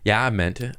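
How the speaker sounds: ordinary talk, nothing marked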